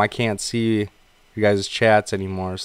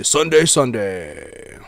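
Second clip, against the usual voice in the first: slow booming announcer echo; monster truck ad; much slower than usual. Shown as a demonstration. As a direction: stretch each Sunday like a truck rally ad. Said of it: "those old monster truck commercials".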